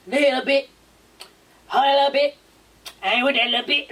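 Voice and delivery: lightly mocking the player's sing-song line; imitating the player. Vlogger mocks the basketball player's line, lightly sing-song. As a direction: parrot his chant, half-mocking. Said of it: "it's rapped".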